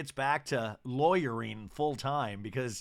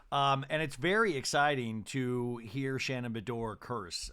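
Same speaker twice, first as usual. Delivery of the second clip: ordinary talk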